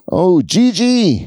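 put-on high, drawn-out Mexican-accented exclamation; imitated Mexican neighbors. Neighbors recognizing the friend by name, imitated Mexican accent. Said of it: "they're like that".

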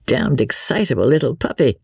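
ordinary delivery

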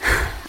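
brief acted sigh; short sigh. A narrator's reading. Kingsley before putting Levi off, a short acted sigh. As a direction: let out a quick breath. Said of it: "Kingsley sighed".

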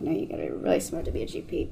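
dropped low and soft, almost breathed; quiet low correction to people who praise her; low hushed retort. Retold or imitated. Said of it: "I'm like".

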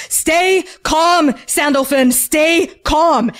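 lightly voiced dad pleading for calm; urgent father. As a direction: call to your child over a roaring wind. Father calls out over roaring magic.

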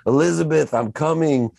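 high, dramatic sitcom wail; hammed-up catchphrase, pitched well up; Red Fox. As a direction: cry out in mock heart-attack melodrama. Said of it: "Red Fox, who he used to always say".

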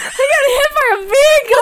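high, loud, laughing outburst; cracking up, voice soaring high; laughing shout. Real, not acted. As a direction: shout it through real laughter. Cracking up recapping the episode.

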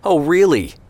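high, loud sarcastic comeback. A narrator's reading. Brother mocking his brother's argument, sarcastic. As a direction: pounce with exaggerated disbelief, pitch swooping down. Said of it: "Isaac said sarcastically".

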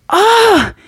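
sharp high angry "Ah!"; a sudden high burst of anger; mom's high angry yelp at the intruder; angry cry. Retold or imitated. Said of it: "She made that noise".

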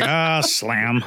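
mock theatrical door slam; hammy stage actor. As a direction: play the slammed door grandly. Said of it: "It's like".

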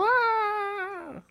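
high shriek of alarm; sudden high scream; retold scream. Actor recalls running from the bar screaming. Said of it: "ran out screaming".

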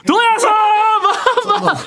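a long, stretched-out high どやさー with a laugh in it; mock radio gag cry, stretched way out; drawn-out gag call. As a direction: ham up the comedian's catchphrase, laughing through it. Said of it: "お互いのギャグの応酬".

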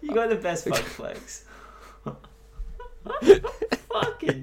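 high, laughing delight; laughing praise. Real, not acted. Podcaster laughing at co-host's impression, high. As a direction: crack up while praising.

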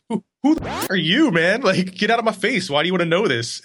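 high, hammed-up indignant snap; mock defensive outburst. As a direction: play your own irritated reaction, half-joking. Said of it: "I'm like".

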